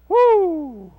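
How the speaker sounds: loud, high falling "woo"; preacher's celebratory holler; exultant whoop. Real, not acted. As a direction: whoop in triumph. Preacher exults after the deliverance.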